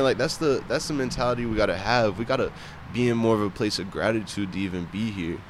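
ordinary delivery

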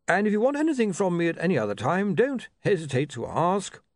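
high, eager academic voice; dotty bursar. Acted. The bursar inviting William to ask him anything, dotty and high-pitched. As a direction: offer help in a high, quick voice.

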